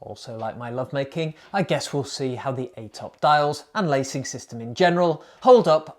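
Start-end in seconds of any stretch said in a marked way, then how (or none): 0.0-1.3 softer, quicker throwaway aside; quiet aside. Acted. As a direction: toss it off quickly under your breath.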